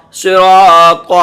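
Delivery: صراط drawn out very slowly and loud; slow teacher's blend of صراط; slow blended reading. Shown as a demonstration. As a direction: stretch صراط syllable by syllable. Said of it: "پہلے آپ دو دو حرف لیں گے".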